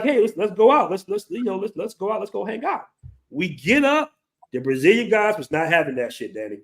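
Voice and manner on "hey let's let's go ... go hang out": imitating the girls. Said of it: "They're like".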